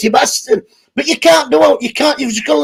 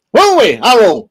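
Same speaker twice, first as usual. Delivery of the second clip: Dickie's voice. Retold or imitated. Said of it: "Dickie doing an impression".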